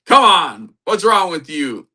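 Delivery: high, loud start, falling in exasperation; mock exasperated. Jesus jokingly scolds doubting Peter, mock exasperated. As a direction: chide like a fed-up friend, hammed up.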